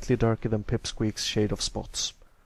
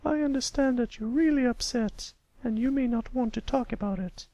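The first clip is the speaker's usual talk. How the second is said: gentle teacher; 14 semitones higher than usual. A narrator's reading. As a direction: comfort a grieving pupil kindly. Teacher comforts grieving pupil.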